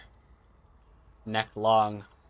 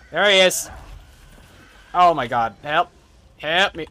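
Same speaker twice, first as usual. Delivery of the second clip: panicked; 5 semitones higher, 8 dB louder than usual. Real, not acted. Enemy jumps out at streamer.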